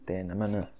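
ordinary talk

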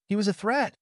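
high, sharp snarl back. A narrator's reading. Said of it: "Odin snarled back".

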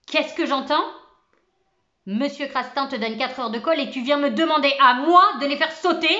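put-on gentle teacher suddenly erupts at the boy, furious; furious outburst. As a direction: blast the word out in fury. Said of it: "fut secoué par une fureur profonde".

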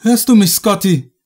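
loud, high anxious call. A narrator's reading. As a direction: call to someone who may not hear. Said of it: "rief sie".

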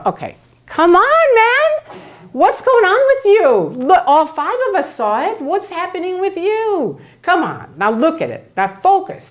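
a group leaning hard on a doubter, mock coaxing; exasperated pressure. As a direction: talk down to him, impatient and pushy. Group pressures dupe again.

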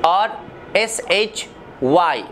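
एस एच वाई spelled slowly; spelling demo. Shown as a demonstration. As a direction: spell shy, then say it. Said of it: "शाई". (धीमी spelling)

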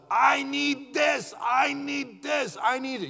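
put-on high, slow whiny child voice; whining child. A preacher mimics a greedy child begging God, high whine. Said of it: "You ever seen a child".

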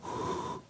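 long audible exhale; demonstrated outward breath; breathing on them. Shown as a demonstration. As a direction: blow out a slow, soft breath. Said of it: "he breathed on them".

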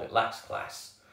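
usual speaking voice

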